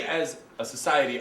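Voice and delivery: ordinary talk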